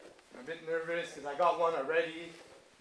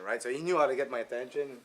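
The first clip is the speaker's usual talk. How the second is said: ordinary delivery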